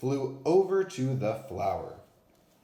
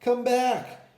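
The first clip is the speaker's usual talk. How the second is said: much higher, loud wail; wailing call; 13 semitones higher, 7 dB louder than usual. A narrator's reading. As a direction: cry out after him as he leaves. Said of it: "his pals wailed".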